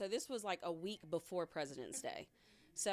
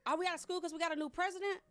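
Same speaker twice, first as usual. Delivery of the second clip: put-on higher, fast eager kid question; kid's voice; 10 semitones higher, faster than usual. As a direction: blurt it like a clueless student. Boy asks a silly question.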